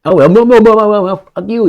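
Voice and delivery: hammed-up furious refusal; mock angry refusal. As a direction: generals swearing at each other, mock shout. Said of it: "they talk like this".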